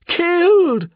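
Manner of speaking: loud, high-pitched cry of grief; anguished scream. A narrator's reading. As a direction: scream each word out high. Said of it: "screamed the man".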